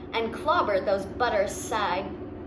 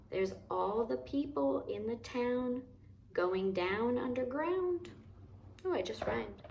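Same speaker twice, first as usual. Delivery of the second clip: much softer, chatty aside; 9 dB quieter than usual. Real, not acted. Reader to child, a much softer hushed aside. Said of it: "aside to listener, much softer".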